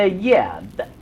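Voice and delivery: ordinary talk, nothing marked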